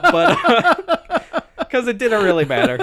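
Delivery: amused, laughter breaking into speech; laughing. Real, not acted. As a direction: laugh it off mid-sentence. Laughs at own nitpicking.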